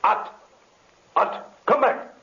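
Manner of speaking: high shouted command; desperate shout. Acted. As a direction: yell after him, frantic. Villain shouting at fleeing zombie.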